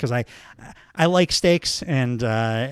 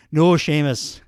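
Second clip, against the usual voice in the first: ordinary delivery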